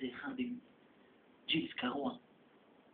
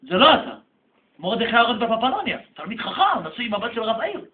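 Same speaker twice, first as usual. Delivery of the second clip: insistent denial; 4 semitones higher, 11 dB louder than usual. Acted. Father denies it is his son.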